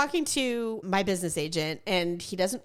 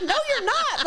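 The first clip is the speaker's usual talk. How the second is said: mocking agent; 14 semitones higher than usual. Mimicking agent's mocking laugh.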